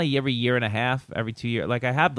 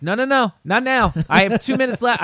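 high, raised-voice protest, re-enacted; calling from the stage; 10 semitones higher, 5 dB louder than usual. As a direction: fend off an interruption mid-set, loud and high. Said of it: "I literally say from the stage".

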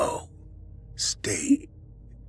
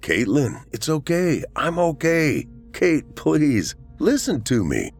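high, pleading husband; anxious, insistent comfort, pitched up; urgent reassurance; 10 semitones higher, slower than usual. A narrator's reading. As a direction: plead with a loved one to listen. Husband urgently reassures crying wife.

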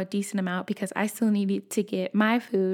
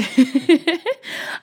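laughing at herself; 7 semitones higher, 5 dB louder than usual. Real, not acted. Laughing at her own anger.

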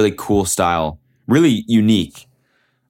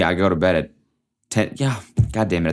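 ordinary talk, nothing marked

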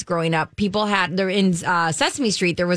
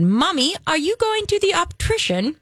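put-on little girl's higher-pitched British accent; 7 semitones higher than usual. Girl asking mom about the eye doctor, imitated British accent. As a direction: imitate an American child talking British. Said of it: "to speak with a British accent".

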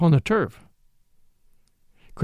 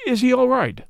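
much higher, then dropping; oddly up and down; 7 semitones higher than usual. A narrator's reading. Flambeau asking about the skeleton, voice oddly unsteady. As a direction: start high and let the question fall away. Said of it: "in a voice that went oddly up and down".

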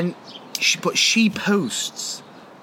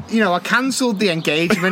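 ordinary talk, nothing marked

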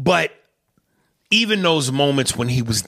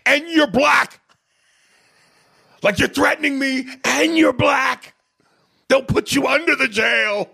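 high, loud, panicked outburst; threatened yelling; 3 dB louder than usual. Retold or imitated. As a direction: yell back as if under threat, imitating. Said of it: "he's yelling out 'cause he's feeling like he's being threatened".

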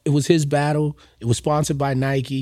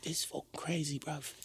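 put-on British accent; 3 semitones higher, 18 dB quieter than usual. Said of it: "he had, like, a, a British accent".

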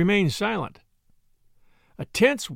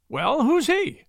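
puzzled; 8 semitones higher, slower than usual. Acted. Drummer puzzled.

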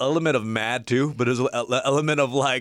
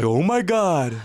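put-on higher K-Town church-kid accent; K-Town Korean accent; 7 semitones higher, 4 dB louder, slower than usual. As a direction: exclaim it like a Korean-American K-Town teen. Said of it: "that's a K-Town dude who goes to church".

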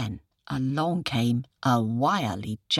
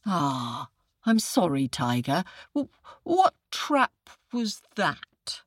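puzzled jackal. Acted. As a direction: play the jackal pretending to be slow. Jackal playing dumb.

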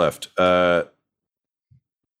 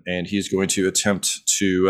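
ordinary delivery

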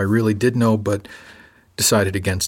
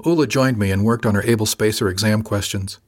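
usual speaking voice

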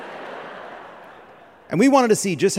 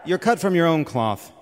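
ordinary talk

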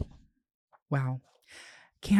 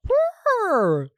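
high excited purr; 5 dB louder than usual. Real, not acted. Excited reaction while reading note.

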